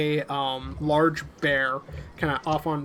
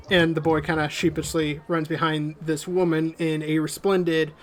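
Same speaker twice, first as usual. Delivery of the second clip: ordinary delivery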